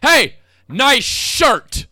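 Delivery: loud, slow, harsh shout; harsh yelled compliment. Shown as a demonstration. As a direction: bark the compliment like an insult. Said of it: "compliment said in a really harsh way".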